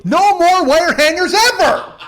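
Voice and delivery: high, loud, slow unhinged scream; hammed-up movie meltdown. Comedian mock-raging at listeners over wire hangers, hammed-up scream. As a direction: scream it like a deranged movie mother. Mommie Dearest style screaming line.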